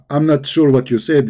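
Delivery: ordinary delivery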